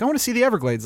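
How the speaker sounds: high-pitched mock tourist gushing; eager tourist. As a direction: imitate a naive tourist wanting to see the swamp. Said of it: "They're like".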